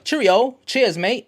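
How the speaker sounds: loud, high, hammed-up English accent; exaggerated British accent, punchy and high; mock English accent. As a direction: shout it like a cartoon Englishman. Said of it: "they have all these accents".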